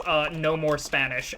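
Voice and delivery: ordinary delivery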